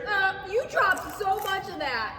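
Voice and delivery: playful teasing. Real, not acted. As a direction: tease her for dropping it. Teasing friend about dropped salsa.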